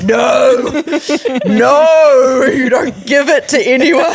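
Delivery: loud, laughing protest, like a panicked dad; flustered loud no. Retold or imitated. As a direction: blurt the no out loud while laughing. Dad flustered by son's question.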